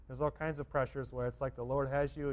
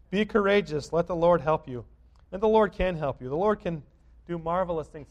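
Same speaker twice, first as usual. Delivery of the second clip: louder, higher sermon exhortation; preaching at full force; 3 semitones higher, 8 dB louder than usual. Acted. Pastor assuring the congregation the Lord helps, fervent preaching. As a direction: preach it out to the pews.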